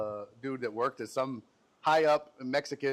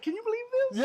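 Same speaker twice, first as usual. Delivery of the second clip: high, squealed disbelief at the clips; high mock amazement; faster than usual. Imitating a friend's high amazed disbelief.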